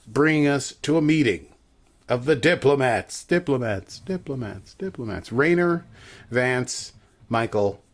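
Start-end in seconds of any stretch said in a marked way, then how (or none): 2.3-5.3 mock echo. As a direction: repeat the word, fading away. Mock echo of a grand word.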